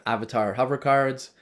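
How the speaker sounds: ordinary talk, nothing marked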